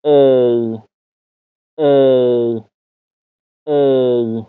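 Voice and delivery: ای drawn out as English "ay"; long A diphthong. Shown as a demonstration. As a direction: glide slowly from E to I on ای. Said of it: "تکرار کنیم".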